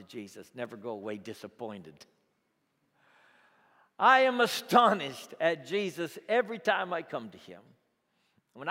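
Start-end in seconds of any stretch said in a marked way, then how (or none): 4.0-7.6 loud, high burst of amazement; real wonder breaking out; astonished. Real, not acted. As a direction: exclaim your astonishment. Preacher exclaims his own amazement.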